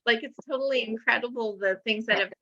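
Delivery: ordinary talk, nothing marked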